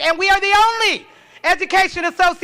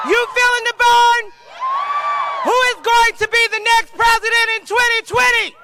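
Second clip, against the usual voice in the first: rally speech; 6 semitones higher than usual. Acted. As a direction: pump up the crowd, shouting. Hypes the crowd.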